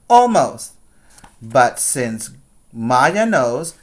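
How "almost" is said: Almost said high and loud, falling; stressed almost. Shown as a demonstration. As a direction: punch Almost as a teasing echo. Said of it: "that means that Grandma almost got her".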